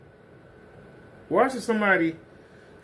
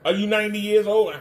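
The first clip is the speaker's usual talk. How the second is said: normal talking voice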